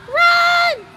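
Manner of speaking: high, loud yells to flee; urgent high holler; panicked shout. Real, not acted. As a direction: scream the order in alarm. Fleeing in the game.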